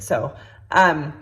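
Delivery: normal talking voice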